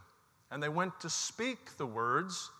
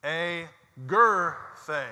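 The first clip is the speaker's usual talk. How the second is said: much louder "A Gerthe", weight on gerthe; loud call; 3 semitones higher, 10 dB louder than usual. Real, not acted. Congregation calling the word aloud, loud. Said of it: "have to be the loudest".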